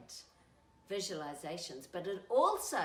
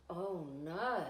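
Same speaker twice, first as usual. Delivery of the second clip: mock worried gasp; 3 semitones lower than usual.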